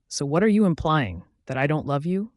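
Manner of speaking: usual speaking voice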